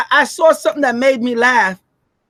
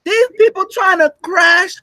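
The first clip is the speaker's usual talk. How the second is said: outraged scolding; 7 semitones higher than usual. Retold or imitated. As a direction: scold like a betrayed partner. America voiced, scolding a partner.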